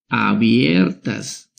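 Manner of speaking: Abiertas drawn out slowly and firmly; slow emphasis. Shown as a demonstration. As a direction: linger on Abiertas so it sticks. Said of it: "estas son vocales".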